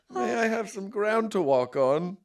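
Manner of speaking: high, slow sarcastic courtesy; sugary fake politeness, slow; mock polite. Hiker to friends blocking the trail, sarcastic courtesy. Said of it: "I'll do, like".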